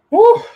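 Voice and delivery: high exhausted whew; big relieved whew; loud whew. Real, not acted. Loud exhale exclamation.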